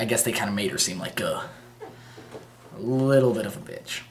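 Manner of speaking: ordinary talk, nothing marked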